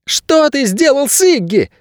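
put-on very high, drawn-out angry child's voice; boy's angry bark. A narrator's reading. Boy confronting Найрод about his missing friend, barking. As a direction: demand it in a piercingly high boy's voice. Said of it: "рявкнул пацан".

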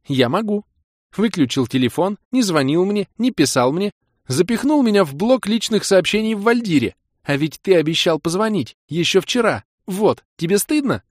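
put-on high, loud young woman counting his sins; hurt Kira laying into him; reproachful girlfriend. Kira listing grievances.

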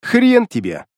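sharp, high defiant refusal; defiant retort. A narrator's reading. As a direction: snap back in defiance. Hero refuses the demon defiantly.

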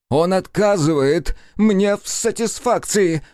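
high, slow outraged cry; indignant shout. A narrator's reading. Nikitin complaining to Rogozin, indignant shout. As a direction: cry out the injustice. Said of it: "выкрикнул Никитин".

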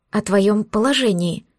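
plain reading voice

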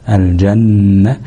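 الجنة drawn out slowly on a humming ن; held ghunna. Shown as a demonstration. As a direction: hold the doubled ن in الجنة as a nasal hum. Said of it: "هنتك على النون شوية". (الجنة باتكاء طويل على النون)

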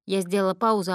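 usual speaking voice

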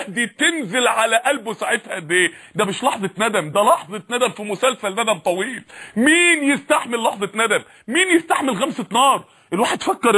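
usual speaking voice

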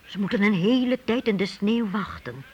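ordinary talk, nothing marked

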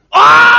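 loud, high scream of sudden horror; piercing shriek, very loud and high. Acted. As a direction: scream as if you just saw something ghastly. Actress finds hand in box.

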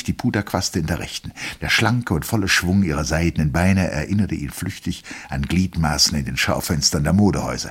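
ordinary delivery

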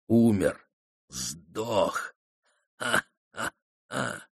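hushed, sneering taunt with a soft laugh; quiet mocking gloat. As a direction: gloat under your breath. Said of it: "Злой дух издевательски захохотал".

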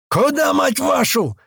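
high, drawn-out indignant shout; angry yell. A narrator's reading. Туман out of a car window, yelling. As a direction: yell it out, stretching the words. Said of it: "заорал из окна Туман".

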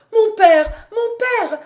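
loud, very high desperate call; despairing cry. A narrator's reading. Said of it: "cria encore Marie avec désespoir". (cri aigu de désespoir)

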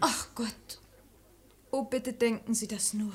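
put-on a wounded girl answering her lover, softly bitter; hurt. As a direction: let the hurt sink the voice.

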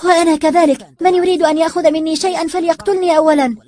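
fierce, high loud shout. A narrator's reading. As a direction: scream your defiance at them. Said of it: "وصاحت أسيل".

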